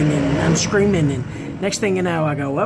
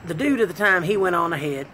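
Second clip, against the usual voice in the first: ordinary talk, nothing marked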